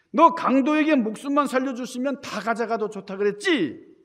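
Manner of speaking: angry father. Retold or imitated. Father scolding his sons for begging the robber. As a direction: scold the sons for what they said. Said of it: "아버지가 화가 나가지고".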